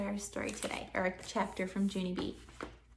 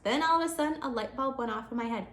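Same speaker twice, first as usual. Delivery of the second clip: ordinary delivery, nothing marked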